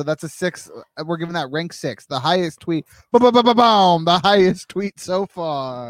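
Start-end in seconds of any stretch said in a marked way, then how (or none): 3.1-5.9 loud, slow, drawn-out announcement; announcer fanfare. Pretend. Host announces the highest tweet so far, loud fanfare. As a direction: herald it like a big reveal.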